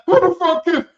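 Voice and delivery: loud, high mock shout. Housemates shout at the announcement, loud and high. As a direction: yell it like a shocked housemate. Contestants shouting in retold scene.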